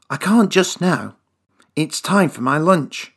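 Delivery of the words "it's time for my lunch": ordinary delivery, nothing marked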